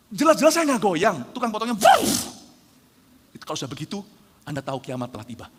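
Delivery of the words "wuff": loud, high "wuff" of clippers; loud comic whoosh; imitated clipper whoosh. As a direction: mimic clippers swooping through hair. Imitates clipper running over hair.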